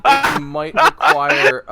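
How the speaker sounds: slow, loud, high through laughter; struggling on through a laugh; real laughing. Real, not acted. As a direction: try to narrate while cracking up. GM trying to narrate while laughing.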